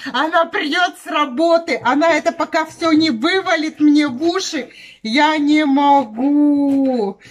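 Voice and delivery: shouting indignantly; fed-up loud venting; loud complaining rant. Acted. Ranting about a friend. (громко и возмущённо)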